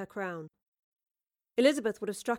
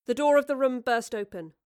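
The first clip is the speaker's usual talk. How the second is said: plain reading voice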